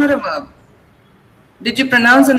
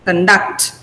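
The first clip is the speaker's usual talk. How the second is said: Conduct as cun-DUCT, weak first vowel; second-syllable stress; 5 semitones lower than usual. Shown as a demonstration. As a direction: land the weight on DUCT in Conduct. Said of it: "I'm stressing duct".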